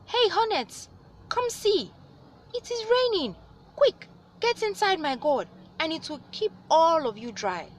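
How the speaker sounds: very high, loud calling voice. A narrator's reading. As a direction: shout up to the hornets, pitched way high. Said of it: "He called out to the hornets".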